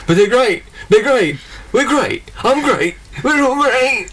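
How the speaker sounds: high, drawn-out tiger boast; cartoon tiger protesting to his wife; insistent catchphrase. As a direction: keep insisting in a high mascot voice. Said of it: "And he's like".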